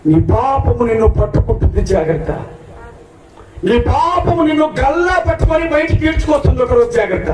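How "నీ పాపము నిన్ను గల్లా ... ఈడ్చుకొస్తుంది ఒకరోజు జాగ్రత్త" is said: high, shouted warning sermon; preacher warning sinners their sin will drag them out; fiery preaching. Real, not acted. Preaching at full force.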